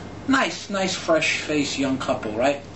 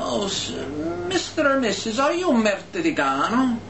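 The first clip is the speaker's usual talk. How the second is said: mock-courteous waiter, pitched up; Italian waiter; 8 semitones higher than usual. Waiter asking tourists an insult as a question, mock-polite. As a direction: ask it high like a sly waiter. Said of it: "this waiter comes up to this couple".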